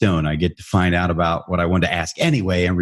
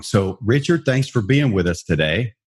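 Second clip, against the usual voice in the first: ordinary delivery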